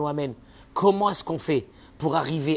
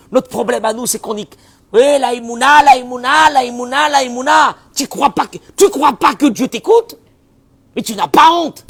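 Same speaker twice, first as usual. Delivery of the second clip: prêche enflammé; 10 semitones higher, 13 dB louder than usual. Real, not acted. Sermonne l'auditeur sur sa foi.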